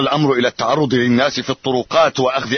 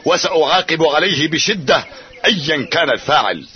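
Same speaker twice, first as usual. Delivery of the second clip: raised voice warning the assembled village; formal public address; 5 semitones higher than usual. Acted. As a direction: speak out firmly to the crowd. Chief warns the whole village.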